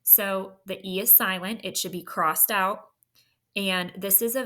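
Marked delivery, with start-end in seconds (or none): none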